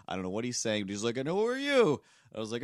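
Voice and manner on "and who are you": put-on high, loud old man's bark; old man demanding.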